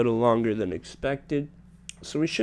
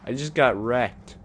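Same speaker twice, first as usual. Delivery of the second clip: usual speaking voice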